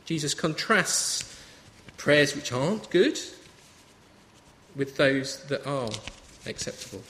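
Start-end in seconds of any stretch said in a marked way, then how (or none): none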